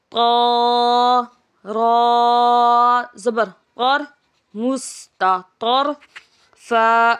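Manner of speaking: slow chant, letters drawn out then joined; Qaida spelling drill. Shown as a demonstration. Teacher chanting letters for pupils, drawn-out sing-song spelling. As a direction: spell it out for a child learning Quran letters.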